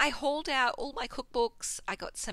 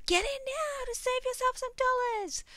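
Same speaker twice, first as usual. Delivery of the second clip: mock ad announcer; 4 dB louder than usual. Mock ad pitch.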